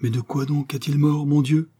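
tearful. A narrator's reading. The countess, shaken by the duke's death, asks tearfully. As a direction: plead through tears, voice lifting at the end. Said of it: "d'une voix pleine de larmes".

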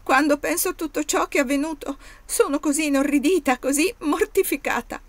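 high, loud tearful outburst; bursting into tears. Acted. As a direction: let the words spill out through sudden tears. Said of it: "scoppiando in lacrime".

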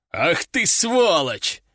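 slow, drawn-out curse; exasperated anger. A narrator's reading. General cursing cheerful recruit, exasperated anger. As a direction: stretch the insult out in anger.

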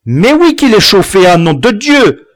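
high, loud choked exclamation; boy out of breath from the fight; gasping retort. Acted. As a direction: blurt it out half-winded. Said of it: "souffla Camus suffoqué".

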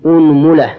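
أنملة said "unmula", u on hamza and mim; u-u vowels. Shown as a demonstration. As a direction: open firmly on u, then "mula". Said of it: "ضم الهمزة".

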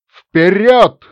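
battle cry. Acted. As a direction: yell the charge with a sore throat. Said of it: "выдал напоследок". (боевой клич охрипшим голосом)

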